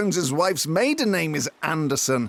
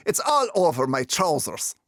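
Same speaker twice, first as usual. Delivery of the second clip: ordinary talk, nothing marked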